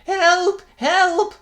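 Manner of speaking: high, loud terrified call; panicked cry for help. A narrator's reading. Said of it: "cried the priest".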